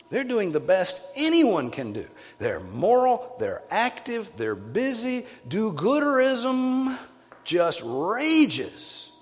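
loud, high, ringing sermon climax; full-force preaching. Acted. Preacher thundering at the moral churchgoers, full-force preaching. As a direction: preach it out hard to the congregation.

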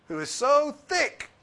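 high, loud, slow mocking whine; mock exasperation. Preacher mocking our impatience with a slow learner. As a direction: drag out "thick" in disbelief.

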